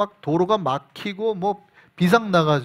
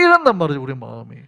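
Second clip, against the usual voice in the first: ordinary talk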